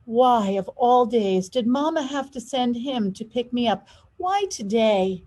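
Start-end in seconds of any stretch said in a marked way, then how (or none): none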